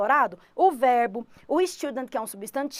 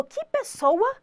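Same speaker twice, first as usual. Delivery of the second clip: Que pessoa with a high rise; rising exclamation; 10 semitones higher, much slower than usual. Shown as a demonstration. Teacher shows students the unfinished exclamation, rising high. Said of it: "Só que você falou".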